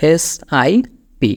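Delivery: slow letter-by-letter spelling of SIP; spelled out slowly. Shown as a demonstration. Teacher spelling a word slowly for learners. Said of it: "ওইটার ইংরেজিটা হচ্ছে".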